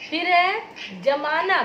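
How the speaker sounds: जमाना said very slowly with a plain ज, as in curdling; slow teaching demo. Shown as a demonstration. Teacher reading a word aloud to students, slow and clear. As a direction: spell out जमाना slowly, ज without a nuqta. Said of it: "बिना नुक्ता के जमाना".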